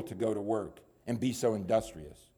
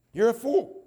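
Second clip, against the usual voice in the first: YOU'RE raised, higher and louder; louder fool; 12 semitones higher, 7 dB louder than usual. Shown as a demonstration. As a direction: hammer it the second time. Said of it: "But you're a fool".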